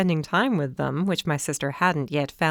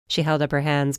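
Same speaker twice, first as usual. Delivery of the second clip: ordinary delivery, nothing marked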